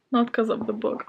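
ordinary delivery

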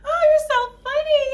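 high, bursting laughter; laughing. Real, not acted. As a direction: laugh through the words. Laughing at boyfriend.

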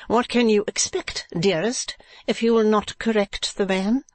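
high wife's voice; Madame Mantalini. Acted. Wife chiding her husband, high character voice.

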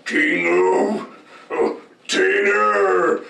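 loud roared move name; roaring grunting brute. Acted. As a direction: roar it out, slowly. Super move callout.

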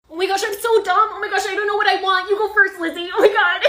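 high, loud gushing; flustered teen. Acted. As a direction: squeal in giddy, hammed-up embarrassment. Flustered teen cannot choose her order.

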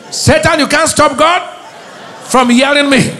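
high, loud, defiant proclamation; shouted declaration. Real, not acted. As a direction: shout it at Satan with the crowd. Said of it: "Say with me".

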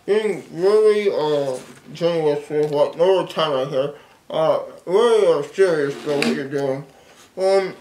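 talking through stuffed cheeks; chewing a big wad; mouth full of gumballs. Real, not acted. Seen 1: cheeks puffed and lips pursed; he keeps feeding more in.

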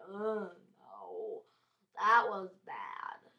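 hammed-up reaction to a blunder; mock dismay. Acting own dismay at a misclick.

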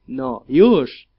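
loud, sharp barked order; shout. A narrator's reading. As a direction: force it out, impatient executioner. Executioner forcing grip loose.

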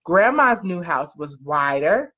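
plain reading voice

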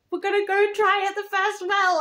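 hopeful characters quoted. Retold or imitated. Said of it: "in the epilogue where they're like".

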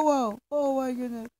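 normal talking voice

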